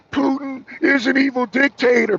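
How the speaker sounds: high mock-shocked pundit voice. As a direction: parody a gasping TV pundit. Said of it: "whoever is so-called".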